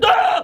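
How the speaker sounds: sudden shriek of fright; short high yelp as the axe hits; startled high scream. Retold or imitated. Road shocked by the exploding wall, high scream. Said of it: "he's just like".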